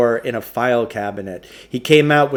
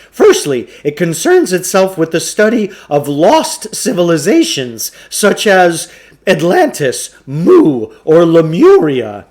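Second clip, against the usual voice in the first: higher, louder salesman's patter; Samuel Klugarsh voice; 6 semitones higher, 9 dB louder than usual. Acted. Shopkeeper pushing a trade-in on the boys, higher, louder salesman voice. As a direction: sell it like an oily bookshop owner.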